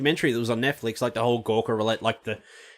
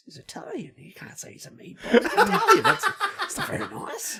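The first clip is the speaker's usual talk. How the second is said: laughing whisper; 4 dB quieter than usual. Real, not acted. Joking whisper about meatball.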